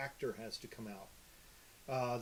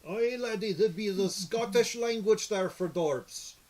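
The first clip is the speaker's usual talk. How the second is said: Scottish dwarf; 5 semitones higher, 8 dB louder than usual. Acted. Dwarf character answering the challenge, loud high Scottish accent. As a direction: roar it out like a Scottish fantasy dwarf. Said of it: "the dwarf".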